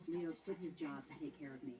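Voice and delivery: usual speaking voice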